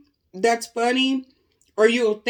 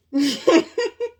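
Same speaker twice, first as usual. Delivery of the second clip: loud cackling laugh; high burst of laughter; 7 semitones higher, 5 dB louder than usual. Real, not acted. Cracking up.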